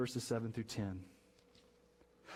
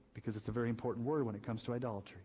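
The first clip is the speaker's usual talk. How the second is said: ordinary talk, nothing marked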